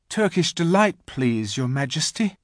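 lightly voiced eager young boy; a hint of a hopeful child; polite boy. A narrator's reading. Edmund names his favourite treat, lightly polite.

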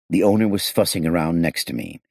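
ordinary delivery, nothing marked

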